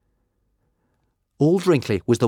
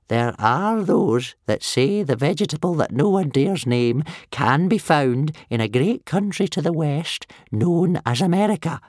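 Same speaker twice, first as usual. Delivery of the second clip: put-on old man voice; 9 semitones higher, 4 dB louder than usual. Wrinkly's old voice.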